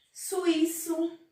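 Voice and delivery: Suíço sounded out as su-Í-ço, high and stressed on the í; clear example word. Shown as a demonstration. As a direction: lift the Í of Suíço so the hiatus shows. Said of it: "Um exemplo de hiato".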